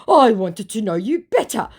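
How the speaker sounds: high, loud overdone protest; mock his earnest pleading; mock-earnest impostor. Impostor wanting to know her better, mock earnest.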